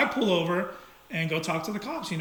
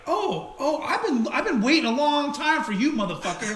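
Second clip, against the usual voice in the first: higher-pitched mock menace, a laugh at the end; mimicked threat; 6 semitones higher than usual. Driver imitating the old foe who has been waiting for him, mock-threatening. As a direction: lightly imitate a riled-up rival.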